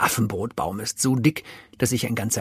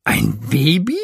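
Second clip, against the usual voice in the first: laute, ungläubige Frage; ungläubiger Ausruf; 3 semitones higher, 5 dB louder than usual. A narrator's reading. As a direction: ruf es fassungslos. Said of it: "ruft Tafiti". (ungläubig gerufen)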